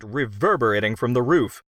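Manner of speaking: plain reading voice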